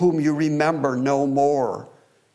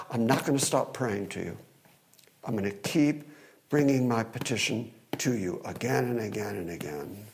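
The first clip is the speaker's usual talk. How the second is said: quiet resolve; 5 semitones lower, 7 dB quieter than usual. Acted. Voicing the suffering psalmist.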